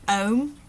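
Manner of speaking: "ohm" for home, with no h at the start; dropped h. Shown as a demonstration. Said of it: "where that H is dropped off".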